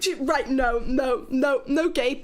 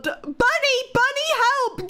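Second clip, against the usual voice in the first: mock call for help; 8 semitones higher, 5 dB louder than usual. Real, not acted. Calling for help jokingly.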